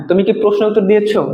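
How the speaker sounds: ordinary talk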